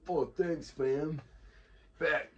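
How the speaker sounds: high, loud, hammed-up young slang speaker; mock slangy job candidate. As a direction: play a clueless kid thanking the boss with slang. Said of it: "you're gonna go to an interview for a job and say".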